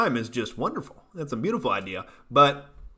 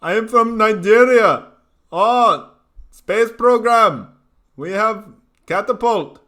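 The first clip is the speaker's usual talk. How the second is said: higher, louder mock Nigerian accent, program drawn out slowly; bad Nigerian accent; 8 semitones higher, 8 dB louder, slower than usual. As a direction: ham up a crude Nigerian accent. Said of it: "Here's Nigeria".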